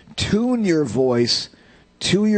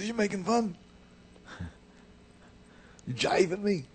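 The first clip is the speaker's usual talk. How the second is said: higher, softer rasping voice; 7 semitones higher, 8 dB quieter than usual. Retold or imitated. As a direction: imitate a raspy jazzman's suspicious question. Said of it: "[name], who talked like this".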